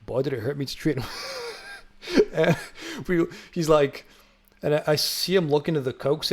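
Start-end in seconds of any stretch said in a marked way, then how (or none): none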